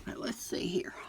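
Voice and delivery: hushed aside while working; whispering. Real, not acted. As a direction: whisper to herself. Whispering to herself mid-task.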